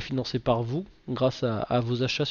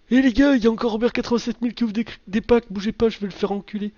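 higher, louder, fast gleeful mock voice; mocking EA employee; 8 semitones higher, 4 dB louder, faster than usual. Imagined EA worker telling colleagues to watch him lose, gleeful and fast. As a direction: play the gloating guy rigging the packs. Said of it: "un gars derrière, avec Electronic Arts en train de se marrer".

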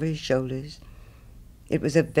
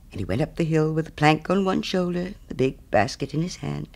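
ordinary delivery, nothing marked

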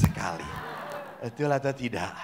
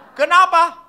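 high, raised challenge; insistent priest; 10 semitones higher, 10 dB louder than usual. Acted. Priest presses children on their regret, high insistent question. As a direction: demand their answer.

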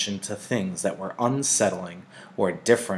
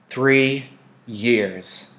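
louder, firm and final; firm mother; 3 semitones higher, 4 dB louder than usual. A narrator's reading. Mother firmly sets the limit for Lily. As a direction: state it with no room to argue. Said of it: "Mother told her firmly".

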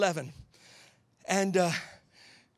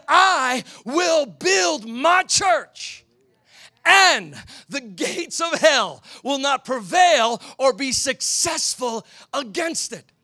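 very slow, louder, higher proclamation; preaching shout; 4 semitones higher, 5 dB louder, much slower than usual. Shown as a demonstration. Preacher proclaiming Jesus' promise, slow booming shout. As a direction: thunder it out to the congregation. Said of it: "Jesus said".

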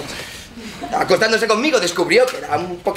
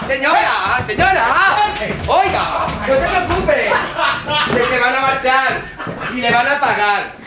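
laughing reassurance; 6 semitones higher than usual. Acted. Calming landlady through laughter.

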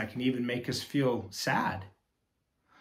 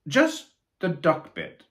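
higher, slow quacky reply; quacked; 6 semitones higher, 8 dB louder than usual. Acted. Platypus answering the knight, quacking. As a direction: quack it matter-of-factly. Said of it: "quacked the platypus".

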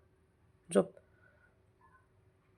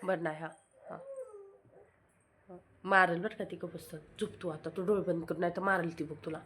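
hushed warning; 2 semitones lower, 13 dB quieter, faster than usual. Real, not acted. Hushing the boy near sleeping baby.

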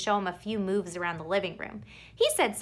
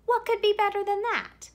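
excited gush; 11 semitones higher, 3 dB louder than usual. Acted. Boy writing home, gushing over his friend's house. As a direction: pitch jumps high, eager and bright-eyed.

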